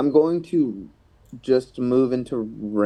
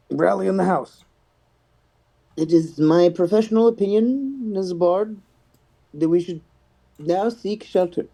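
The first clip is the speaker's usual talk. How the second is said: higher-pitched, measured announcement with pauses; bard's proclamation; 8 semitones higher than usual. Acted. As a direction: proclaim it as a self-important bard. Player proclaims in character as bard.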